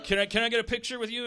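high, fast, loud eager asking; gushing fan racing to ask. Retold or imitated. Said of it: "And then they're like".

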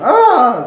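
a sudden high loud mock shriek; imitated scream. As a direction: shriek like a startled neighbour. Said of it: "And he just went".

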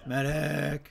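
urgent yell for a medic; man down shout. Real, not acted. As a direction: call out loud for help, wounded. Still calling for medic.

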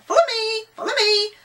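very high, loud parrot squawk. A narrator's reading. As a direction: screech it like a calling parrot. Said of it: "Still squawking".

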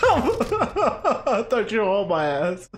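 slow words through laughter; laughing. Real, not acted. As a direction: giggle it out. Laughs at false alarm.

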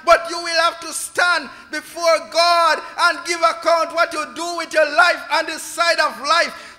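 ordinary talk, nothing marked

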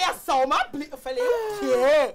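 loud retold shriek of disbelief; outraged yelling. Girlfriend answering boyfriend who shrugged off the blitz, outraged shouting. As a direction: throw the words back, yelling. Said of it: "eu gritar".

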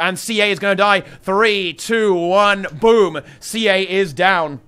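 rising hype, calling the kill; excited countdown. Real, not acted. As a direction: count it down loud and thrilled.

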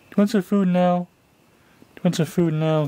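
high, sing-song cooing at the cat. Real, not acted. Owner coaxing her cat with treats, high baby talk.

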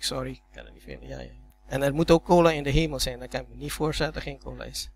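ordinary delivery

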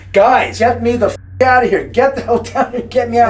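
high, loud frantic plea; panicked yelling. Retold or imitated. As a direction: beg to be let out, terrified. Nick panicking in the basement.

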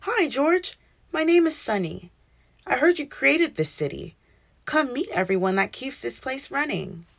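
high, friendly sun voice; friendly sunny voice, lightly voiced; warm, friendly. A narrator's reading. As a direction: greet him kindly and brightly. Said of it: "a warm, friendly voice".

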